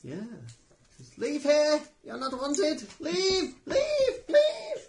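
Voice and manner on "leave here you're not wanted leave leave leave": high, shrieking, screeching bird demon; harsh squeaky voice. Acted. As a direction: screech it out, rising each time. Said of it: "like a harsh, squeaky voice".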